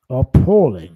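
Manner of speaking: one loud, disgusted word; real disgust, loud; angry outburst. Real, not acted. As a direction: bark out your disgust. Ranting at rude commenters.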